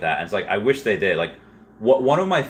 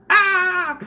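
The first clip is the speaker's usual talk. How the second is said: very high sharp mock scream; imitating Wendy's scream; 4 dB louder than usual. Mimicking Wendy's clueless scream, very high and sudden. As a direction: burst out with a startled high yelp. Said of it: "Wendy had that face, like".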